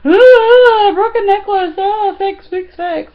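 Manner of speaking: high, loud, hammed-up wail; frantic whining plea. Wife begging husband to fix a broken necklace, mock wailing. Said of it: "and I'm like".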